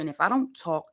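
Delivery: ordinary talk, nothing marked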